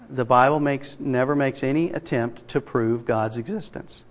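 normal talking voice

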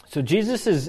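ordinary talk, nothing marked

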